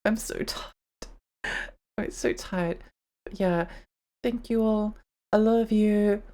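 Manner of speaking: ordinary talk, nothing marked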